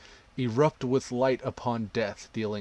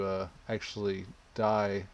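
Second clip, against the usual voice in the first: ordinary talk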